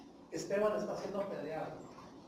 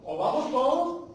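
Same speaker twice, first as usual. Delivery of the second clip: louder and higher, pushing the point; emphatic insistence; 6 semitones higher, 6 dB louder than usual. Acted. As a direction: plant your feet and insist. Moses insists to God.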